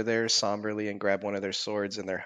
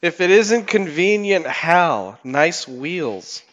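higher, louder, slow hail; mock grand greeting; 6 semitones higher, 7 dB louder, much slower than usual. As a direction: greet him with big fake warmth. Player hails NPC theatrically.

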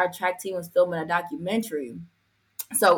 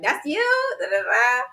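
much higher, squealed question; squealing girls; 10 semitones higher, slower than usual. Retold or imitated. Girls at school spotting her, high excited squeal. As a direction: squeal it like an excited teen girl. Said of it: "All the girls just like".

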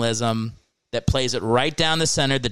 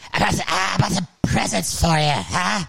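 higher, hammed-up demon voice; 7 semitones higher than usual. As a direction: tease the crowd like a demon. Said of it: "he was talking like a demon".

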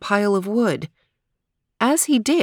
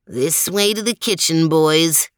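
a hint of a confident old dog; lightly voiced, leading the pack. Lestrade leads.

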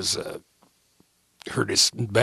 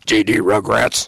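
gruff grumble; 6 dB louder, slower than usual. Retold or imitated. Gruff wrestler's retold grumble.

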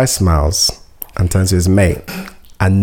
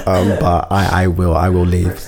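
usual speaking voice

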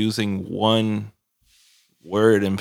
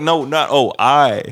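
higher, louder correcting; exasperated correction; 4 semitones higher, 7 dB louder, slower than usual. Retold or imitated. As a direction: set them straight, exasperated.

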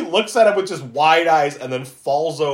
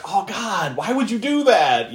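higher, cringing plea; secondhand embarrassment; 5 semitones higher, slower than usual. Acted. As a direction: groan it out as if embarrassed for someone. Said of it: "like, that secondhand embarrassment".

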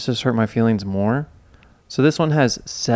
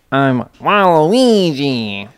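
slow, louder cartoon villain voice; Waluigi voice; 3 semitones higher, 5 dB louder, much slower than usual. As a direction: drag out the name like a cartoon villain. Announcing costume character.

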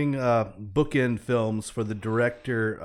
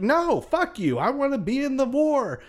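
put-on much higher, defiant child's retort; defiant kid. Orphan boy refusing to be sent away, high defiant kid voice. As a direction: play a boy snapping back at the grown-ups. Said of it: "And the kid's like".

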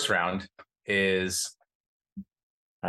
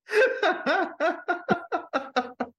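high laugh. Real, not acted. Reacting to buildup.

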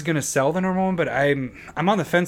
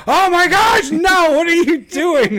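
high, loud mock-horrified yell; horrified shout; 7 dB louder, slower than usual. Dad reenacting catching his son mid-mishap, shrieking in horror. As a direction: cry out like an appalled parent.

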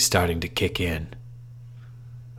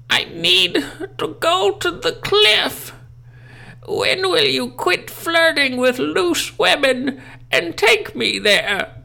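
put-on very high, slow old-lady wail; haunting voice; slower than usual. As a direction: wail like a ghostly granny. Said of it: "grandmother's voice cry on the phone".

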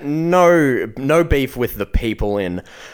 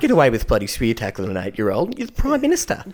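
high, indignant lady at the footy; mock outrage from a football fan; squawky mock-indignant woman yelling at the screen; old lady voice; 11 semitones higher than usual. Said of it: "she sees that photo and goes".

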